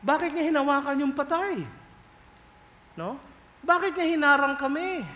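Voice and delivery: high, questioning bystanders; imitating the crowd.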